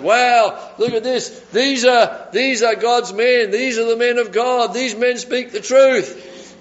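hammed-up possessed woman gushing; demon-possessed woman. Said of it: "demon-possessed woman who's going around".

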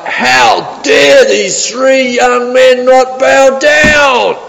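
high, slow, roaring outrage at the defiant men; furious king's shout. Retold or imitated. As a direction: bellow it like a tyrant king beside himself. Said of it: "in his rage and fury".

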